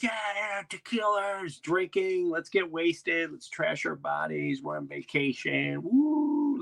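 high-pitched mock party crowd whooping; drawn-out, high cheer of drunken vacationers; partying tourists. Mimicking partying tourists on the boat.

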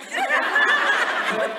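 bursts into a high laugh. Real, not acted. Laughs at own joke.

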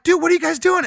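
high, loud incredulous question; retold alarm, high-pitched and loud. Retelling his shock at swimmers.

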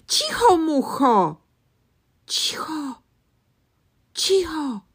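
high, loud hushing cries; shouting. Acted. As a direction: shout at the fly to hush. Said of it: "krzyczeli goście".

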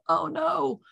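high, alarmed cry of dismay; high-pitched mock panic; retold panic. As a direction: relive the moment you fear you caused harm. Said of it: "I'm like".

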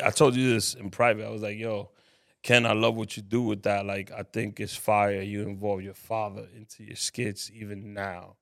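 ordinary delivery

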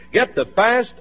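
normal talking voice

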